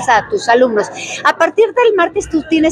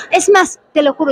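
ordinary talk

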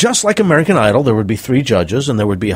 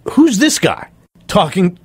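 higher-pitched, indignant quoted question; upset; 7 semitones higher than usual. Retold or imitated. Network people question the producer about a stranger, upset retelling. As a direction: demand an answer, mock-indignant. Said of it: "they were upset".

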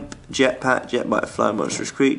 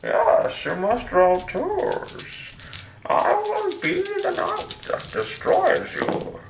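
villain voice; 4 dB quieter, much slower than usual. Pretend. Gloating villain over dice roll.

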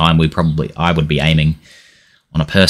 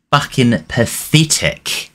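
slow, contemptuous verdict, "pathetic" falling; disgusted; 6 semitones higher, slower than usual. Real, not acted. Conceding a penalty at home.

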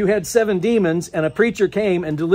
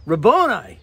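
a touch of recognition in the name; mild joyful call; 3 semitones higher than usual. Acted. Preacher retelling Mary's cry, lightly excited. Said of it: "she says".

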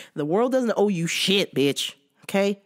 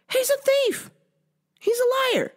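outraged. Real, not acted. Outraged rant about thieving ex-boss.

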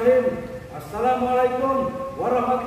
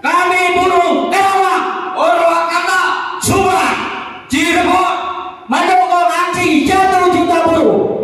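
high, loud, forceful slogans; rally shout; 5 semitones higher, 6 dB louder than usual. Real, not acted. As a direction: bellow it to the crowd.

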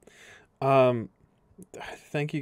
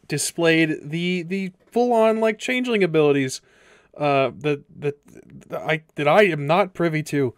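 excited; 5 semitones higher, 8 dB louder than usual. Real, not acted. Excited recap after session.

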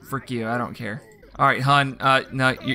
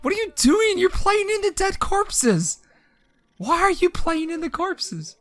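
higher, prissy flustered squeak; mock embarrassed sim; 12 semitones higher than usual. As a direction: voice the fussy sim aghast. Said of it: "He's so embarrassed, like".

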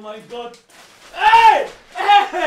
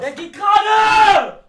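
annoyed shout; 4 semitones higher, 6 dB louder, slower than usual. Real, not acted. Arguing while building goal.

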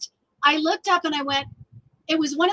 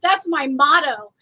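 excited exclamation; 2 semitones higher, 7 dB louder than usual. Retold or imitated. Artist recalling her reaction to a radio song, excited. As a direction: retell a delighted sudden realization.

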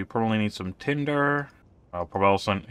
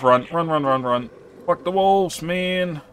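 rising excited urging, higher pitch; urgent excitement; 8 semitones higher, slower than usual. Real, not acted. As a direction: hurry yourself along, high and excited. Fleeing wolves in game.

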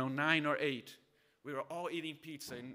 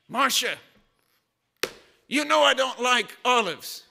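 loud, higher gruff complaint, with a laugh breaking in; stern army husband; 8 semitones higher, 16 dB louder than usual. Retold or imitated. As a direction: grumble to your wife like a general. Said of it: "he's kinda like a general".

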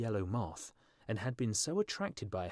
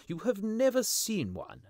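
lightly acted schoolmaster, high and loud; mathematical master; 12 semitones higher, 5 dB louder than usual. A narrator's reading. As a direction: object loudly in a raised schoolmaster's voice. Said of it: "said the mathematical master".

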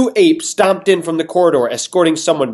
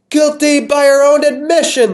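high, loud royal pronouncement; proclaimed; 13 semitones higher, 5 dB louder than usual. Acted. King declaring her guilty to the crowd, loud. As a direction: ring it out to the whole hall. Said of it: "the king proclaimed".

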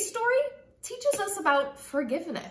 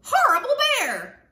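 high, loud little bat squeak; 10 semitones higher, 7 dB louder than usual. A narrator's reading. Bat squeaking at the practicing bear, high squeaky shout. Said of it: "Bat squeaked".

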